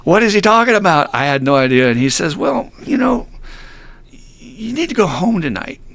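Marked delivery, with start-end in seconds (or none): 0.0-1.1 high, loud mock bewilderment; incredulous, pitched way up; baffled. Bafflement at old timer retold. 2.4-5.8 high, slowed imitation of an older man; imitated old timer, unhurried. Old timer's advice retold.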